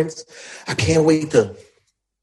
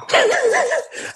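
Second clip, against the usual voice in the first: high laugh; 5 dB quieter than usual. Real, not acted. Laughing at going broke.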